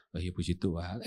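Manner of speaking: low, soft gentle answer; reply sweetly and patiently; meek pastor reply. Retold or imitated. Pastor answering the neighbour, soft and gentle.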